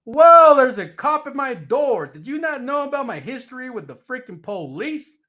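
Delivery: high, loud startled whoa; shocked to find a cop at his door; alarmed surprise. Retold or imitated. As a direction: jump back at the cop at the door. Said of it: "And I'm like".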